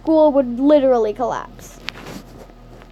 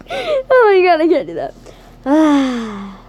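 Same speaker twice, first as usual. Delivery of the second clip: laughing. Real, not acted. Narrator laughing, breaking character.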